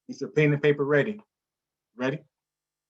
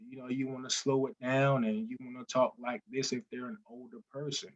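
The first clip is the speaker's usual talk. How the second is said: talking slower; 8 dB quieter than usual. Shown as a demonstration. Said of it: "you wanna talk slower".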